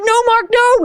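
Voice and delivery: high pleading protest; high pleading no to her coach. Retold or imitated. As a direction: beg the coach to stop. Begging trainer to stop.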